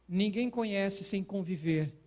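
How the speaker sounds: ordinary delivery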